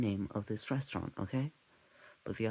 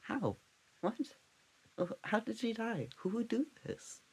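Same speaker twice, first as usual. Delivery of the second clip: baffled authorities; 9 semitones higher than usual. Retold or imitated. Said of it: "the authorities".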